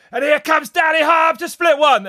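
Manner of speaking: high, loud, hyped commentator call; over-the-top shouting commentator; shouted race call. Retold or imitated. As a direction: belt it like a live downhill race call.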